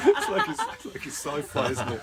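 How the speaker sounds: amused, talking through real laughter; laughing. Real, not acted. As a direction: say it while still laughing, starting high. Laughs at addressing unseen online audience.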